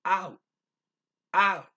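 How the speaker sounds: "Ow" with a strong ah, faint oo; emphatic AH gliding into a light "oo"; stress on the A. Shown as a demonstration. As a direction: punch the start of "Ow", let it fade. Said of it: "it is emphasized, but only the A is".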